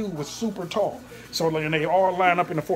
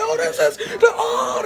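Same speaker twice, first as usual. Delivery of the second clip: high, loud fan squeal; crazed fan excitement; 7 dB louder, slower than usual. Retold or imitated. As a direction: imitate fans losing it at seeing stars. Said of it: "they was going crazy".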